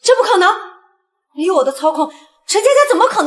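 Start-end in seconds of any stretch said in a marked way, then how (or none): 0.0-0.5 loud, high disbelief; shocked outburst. Acted. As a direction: shout it in stunned disbelief. Shocked the plan failed.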